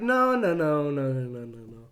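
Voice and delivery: no repeated, fading from normal to very soft; teasing refusal. Retold or imitated. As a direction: trail the nos off into a hush.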